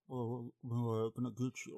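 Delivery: soft, menacing killer voice; a hint of a quiet creepy killer; Buffalo Bill. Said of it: "more like, um, Buffalo Bill".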